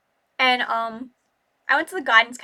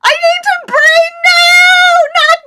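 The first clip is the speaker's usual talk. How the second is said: anguished wail; 13 dB louder, much slower than usual. Retold or imitated. Horror at misspelled name.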